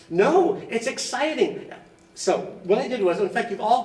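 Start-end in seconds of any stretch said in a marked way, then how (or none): 0.1-1.7 high, emphatic burst of enthusiasm; high-pitched, drawn-out insistence; excited rebuttal. Real, not acted. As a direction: protest eagerly, pitch leaping up, stretching the words. Rebuts friends' groans about language class.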